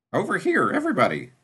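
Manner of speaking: high, loud call across a room; yelling. A narrator's reading. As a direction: call everyone over from a distance. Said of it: "yelled from across the room".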